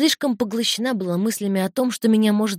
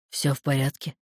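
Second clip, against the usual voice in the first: lightly voiced husband, lower; low, restrained check-in; husband's question; 5 semitones lower than usual. A narrator's reading. Муж спрашивает жену. (низким мужским голосом, сдержанно)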